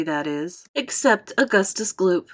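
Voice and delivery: plain reading voice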